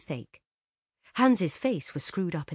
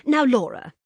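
quickly; 8 semitones higher, 10 dB louder than usual. A narrator's reading. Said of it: "said her mother quickly".

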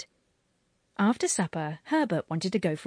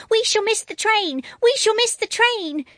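put-on high, loud panicky little boy; shrieking child; 7 dB louder than usual. A narrator's reading. Boy rushing in to warn family, shrieking high. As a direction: shriek it out like a boy afraid to miss the train.

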